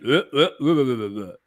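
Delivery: mock garbled babble; tongue-tied stumbling. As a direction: replay the verbal stumble as nonsense syllables. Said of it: "here's a highlight".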